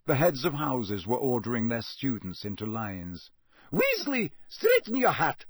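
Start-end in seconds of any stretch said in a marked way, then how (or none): 3.7-5.4 high-pitched, sharp teacher's order; stern, high reprimand; snapping. A narrator's reading. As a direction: snap at a pupil in a high voice. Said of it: "Professor McGonagall snapped at Ron".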